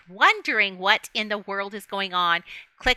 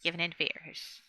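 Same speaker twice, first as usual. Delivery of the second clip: normal talking voice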